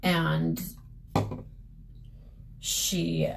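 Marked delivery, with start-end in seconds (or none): none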